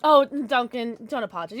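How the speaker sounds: usual speaking voice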